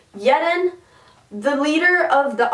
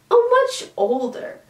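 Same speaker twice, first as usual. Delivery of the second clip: teasing jab; 12 semitones higher than usual. Retold or imitated. Vin teasing Ellen.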